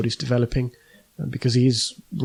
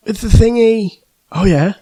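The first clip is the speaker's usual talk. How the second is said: put-on high, loud little kid voice; squeaky child mumbling; 10 semitones higher, 10 dB louder, slower than usual. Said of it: "those Look East interviews with children".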